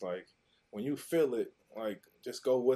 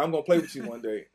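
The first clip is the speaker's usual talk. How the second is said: excited; 4 semitones higher, 7 dB louder, faster than usual. Retold or imitated. Kid self promising cousin.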